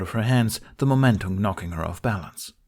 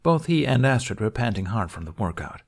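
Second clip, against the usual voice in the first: ordinary delivery, nothing marked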